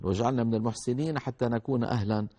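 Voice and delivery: ordinary talk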